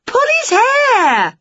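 high, loud, slow shout. Acted. Witch shouting at the wrestling on telly, high and loud. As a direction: bellow it at the TV. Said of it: "she shouted".

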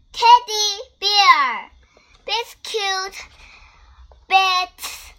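loud, bouncy sing-song storytelling; excited reading. Acted. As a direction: read it out big and happy. Lively sing-song finish to story.

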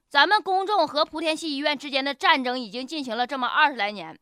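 ordinary delivery

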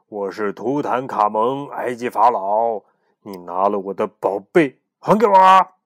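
a pharaoh demanding his treasure; stern pharaoh. Acted.

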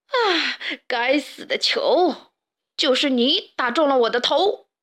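hammed-up cry of agony; pained shouting. A narrator's reading. Stray pig accusing the ball in Loudmouth's hands, pained shouting. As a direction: curse the ball in drawn-out, put-on pain. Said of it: "痛苦地大喊".

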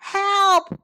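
high, loud call of alarm; crying for help. A narrator's reading. Rabbits to all as developers arrive, crying out. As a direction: call out urgently, drawn out. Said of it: "cried the rabbits".

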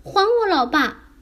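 high-pitched indignant demand; high yell. Acted. Little fish popping up to demand its dad, yelling. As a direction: cry out as a small upset creature. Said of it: "大叫着".

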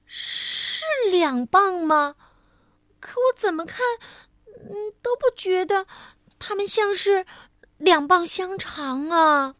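a muddled lady squinting at the coins, baffled; high puzzled voice of a confused lady. Acted. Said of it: "糊涂小姐疑惑地说".

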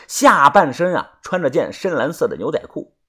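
plain reading voice

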